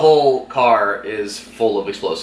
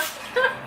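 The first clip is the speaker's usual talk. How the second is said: high giggle; 7 dB quieter than usual. Real, not acted. Laughing at Rachel's denial.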